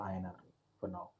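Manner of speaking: very low volume, hard to hear; speaking too quietly for the call; barely audible. Real, not acted. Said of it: "your voice is very low".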